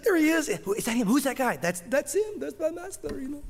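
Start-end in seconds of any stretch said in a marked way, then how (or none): 0.0-1.6 put-on excited girl. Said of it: "And she's like". 1.6-3.2 proud servant. Retold or imitated. Servant answers the bride.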